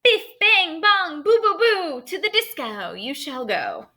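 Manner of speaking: hammed-up fairy magic chant, rising high on biff bang bong; fairy casting a spell. Teacher reads the fairy's spell to the class, high and chanting.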